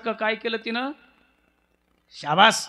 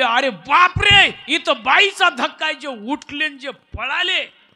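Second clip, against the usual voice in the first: loud, high, slow alarmed shout; the sage jumping up in alarm at the woman's touch; the sage Shuk's loud, high, startled cry; 5 semitones higher, 9 dB louder than usual. Acted.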